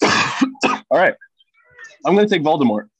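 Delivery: choked-up after coughing; choking. Real, not acted. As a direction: speak through a caught throat. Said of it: "excuse me, I'm choking".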